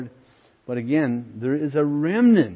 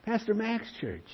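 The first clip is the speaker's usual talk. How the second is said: eager retelling; 7 semitones higher, 4 dB louder than usual. Retold or imitated. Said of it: "He says".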